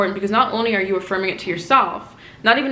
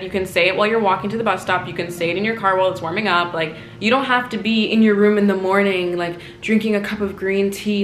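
ordinary delivery

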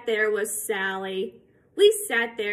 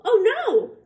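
loud high Oh no; 11 semitones higher, 8 dB louder than usual. Acted. Cat boasting more.

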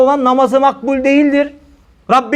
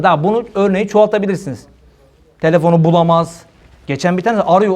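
ordinary delivery

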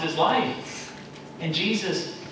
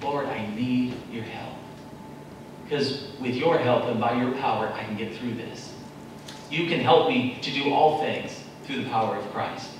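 soft, lower earnest plea; humble prayer; 5 semitones lower, 4 dB quieter than usual. Acted. Believer praying softly to the Lord for help. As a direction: pray quietly for help. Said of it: "to cry to him".

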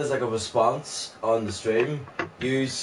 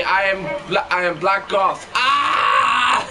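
shout; 6 semitones higher, 3 dB louder, much slower than usual. Real, not acted. Shouting in game.

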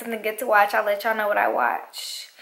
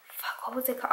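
whisper; 12 dB quieter, faster than usual. Real, not acted. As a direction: whisper while trying to remember. Whispering while recalling a title.

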